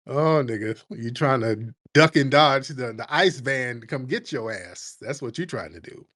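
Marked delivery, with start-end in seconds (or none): none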